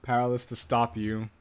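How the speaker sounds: low, soft narration read; story narration. A narrator's reading. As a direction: read the scene in a low hushed voice.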